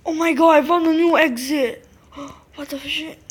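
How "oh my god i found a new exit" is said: much louder, thrilled discovery; excited shout. Real, not acted. Player finding a secret exit, shouting with excitement. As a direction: yell it out, overjoyed at the find. Discovers hidden passage in game.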